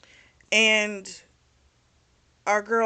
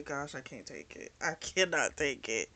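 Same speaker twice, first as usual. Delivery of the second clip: much softer, hushed mock anguish; mock despair; 6 semitones lower, 10 dB quieter than usual. The vlogger reacts to the love triangle, hushed mock despair. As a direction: sigh it out like it's too much to bear. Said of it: "I say".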